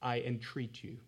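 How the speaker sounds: soft, earnest plea; pleading quietly. Retold or imitated. Paul pleading softly with the church, hushed plea. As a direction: beg them gently and quietly. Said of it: "Paul's saying".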